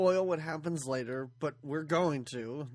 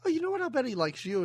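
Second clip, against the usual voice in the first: mock reassuring heroine; 8 semitones higher, faster than usual. Imitating the heroine reassuring the butler.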